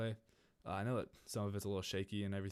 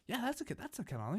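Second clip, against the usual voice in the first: put-on very high, eager kid voice; squeaky-high retold enthusiasm; excited confirmation; 14 semitones higher than usual. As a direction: imitate an oblivious friend blurting it out. Friend excitedly confirms the account.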